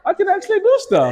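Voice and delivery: a sudden thrilled discovery; excited realization. Retold or imitated. Buying a second property, thrilled and high-pitched. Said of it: "and I'm like".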